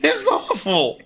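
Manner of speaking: high, indignant goblin tirade; goblin complaint rant. Acted. Goblin ranting about adventurers breaking into homes. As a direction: vent like a fed-up goblin.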